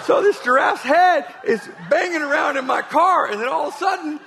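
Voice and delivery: can barely keep a straight face; preacher cracking up at the giraffe tale; laughing through story. Retold or imitated. Retelling absurd giraffe story.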